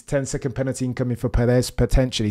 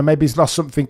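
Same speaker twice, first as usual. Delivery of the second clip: ordinary delivery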